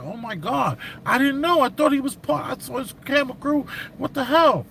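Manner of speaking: high, startled oh my God and sorry; mock stunned realisation of his mistake; shocked apology. Guy apologising to the civilian he hit, high and shocked. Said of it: "So he's super apologetic".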